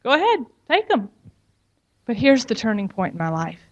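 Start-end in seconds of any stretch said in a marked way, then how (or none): 0.0-1.1 high, hurried voice anxious to appease; eager to please. Retold or imitated. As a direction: offer it up fast to keep him calm. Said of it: "I was like".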